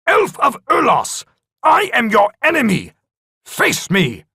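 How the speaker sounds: defiant shout across the cavern; shouted challenge. Acted. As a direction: call the enemy out to fight. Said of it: "he shouted".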